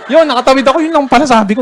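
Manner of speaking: ordinary delivery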